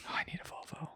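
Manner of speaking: soft unvoiced whisper aside. Real, not acted. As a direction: whisper it under your breath. Quiet aside.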